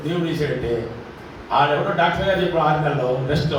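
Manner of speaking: ordinary delivery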